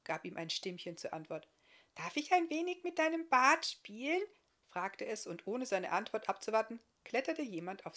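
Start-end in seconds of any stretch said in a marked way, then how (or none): 1.9-4.2 put-on higher, louder tiny child's voice; little girl's voice. A small girl answering the guest, high and bright. Little Agi asks to play.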